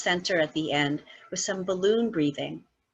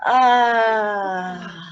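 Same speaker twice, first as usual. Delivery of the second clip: sighing ah; 7 dB louder than usual. Shown as a demonstration. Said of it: "sigh it out".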